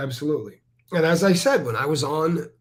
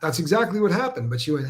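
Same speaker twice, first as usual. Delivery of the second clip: usual speaking voice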